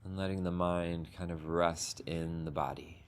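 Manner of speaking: unhurried, gentle meditation guidance with long pauses; guided meditation. Acted. Teacher guiding the group into a sitting meditation, calm and unhurried. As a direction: ease the group into stillness, one phrase at a time.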